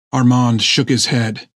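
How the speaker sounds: ordinary delivery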